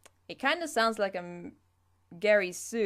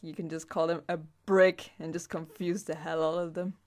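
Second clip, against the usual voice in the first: ordinary delivery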